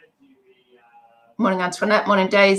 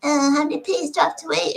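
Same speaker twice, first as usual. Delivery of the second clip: higher put-on whining child; imitating a child complaining at dinner in a higher whine; whiny kid; 11 semitones higher than usual. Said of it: "somebody going".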